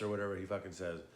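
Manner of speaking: ordinary delivery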